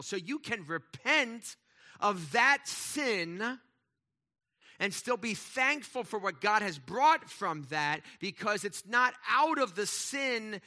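pleading sermon build. Real, not acted. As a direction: preach it with rising force. Preacher driving point home.

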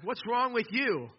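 high, slow, indignant demand; emphatic grumbling. Acted. Thirsty follower confronts Moses, emphatic grumbling. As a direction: stretch the question out and pitch it up. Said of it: "You wanna grumble against that".

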